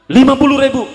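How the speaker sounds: loud, high incredulous cry; preacher shocked at the price demanded, loud. Retold or imitated. Grandpa names price, shocked.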